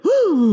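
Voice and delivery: high, loud whoop; excited whoop. Retold or imitated. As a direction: whoop as the ball bounces. Re-enacts his reaction to the bounce.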